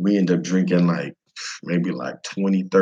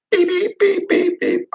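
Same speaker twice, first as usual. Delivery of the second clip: phone beeping. Retold or imitated. Imitating the phone's wifi signal.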